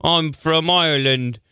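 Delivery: badly faked Irish accent; a hammed-up, muddled Irish brogue; fake Irish accent. Host becomes Mike the Mick on request, fake Irish accent. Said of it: "you could do an Irish accent".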